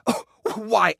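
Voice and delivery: ordinary talk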